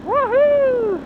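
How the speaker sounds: high, loud whoop; cheering. Real, not acted. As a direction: whoop for the jump. Cheering a bike jump.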